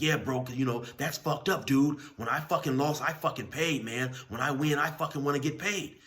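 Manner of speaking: aggrieved inmate. Retold or imitated. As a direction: press him hard for what he's owed. Skinny dude complains about debt.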